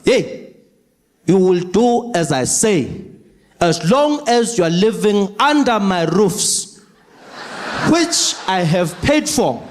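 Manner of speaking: slow, commanding father; stern dad. Retold or imitated. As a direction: lay down the law slowly. Father scolding daughter.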